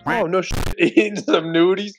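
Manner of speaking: ordinary talk, nothing marked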